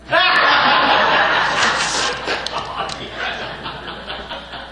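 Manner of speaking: laughing. Real, not acted. Laughs at his own punchline.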